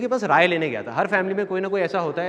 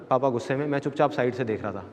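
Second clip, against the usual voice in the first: usual speaking voice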